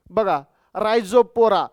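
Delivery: teacher introducing a plant name; drawn-out name. Shown as a demonstration. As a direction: savour the word रायझोपोरा. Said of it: "drawn-out plant name".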